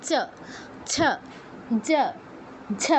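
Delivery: চ, ছ, জ, ঝ each as tongue-on-palate syllables; separated, teacherly চ, ছ, জ, ঝ; palatal demonstration. Shown as a demonstration. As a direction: say চ, ছ, জ, ঝ one by one with short 'uh'. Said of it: "জিহ্বা তালুতে স্পর্শ করে".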